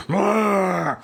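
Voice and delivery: loud, high camel bellow; grumbling camel voice. Shown as a demonstration. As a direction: bray it out like a camel. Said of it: "it might also be the camel sound".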